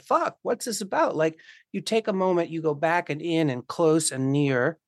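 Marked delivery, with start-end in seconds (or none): 0.0-1.1 startled. Retold or imitated. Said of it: "to be like".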